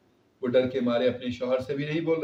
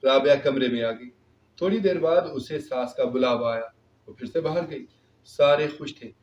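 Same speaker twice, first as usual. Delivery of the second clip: ordinary delivery, nothing marked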